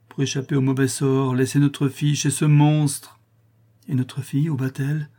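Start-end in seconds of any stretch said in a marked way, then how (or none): none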